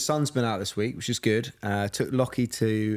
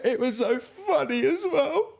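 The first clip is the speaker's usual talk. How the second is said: much higher, slow mock teary laugh; imitating laughing through tears. Husband imitating his wife laughing while crying, much higher. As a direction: gush between sobs and giggles. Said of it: "And she was going".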